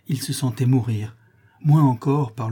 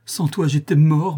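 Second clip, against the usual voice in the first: slow, higher and louder exclamation; grateful outburst; 5 semitones higher, 6 dB louder than usual. A narrator's reading. Rescued man thanks the lover who saved him, grateful outburst. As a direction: cry out with overwhelming gratitude. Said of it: "il s'écria avec une explosion de reconnaissance".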